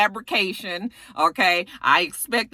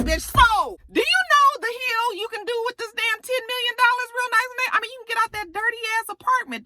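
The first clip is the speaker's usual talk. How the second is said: exasperated. Retold or imitated. Exasperated rant at Nate.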